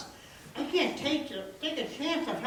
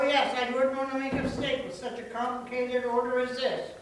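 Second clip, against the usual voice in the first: ordinary talk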